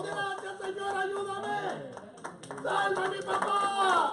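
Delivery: high, loud, long-held acted shout; crying out full-throated. Daughter begging God to save papá, crying out full-throated. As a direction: shout the prayer at the top of your lungs. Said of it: "comenzó a clamar a voz en cuello".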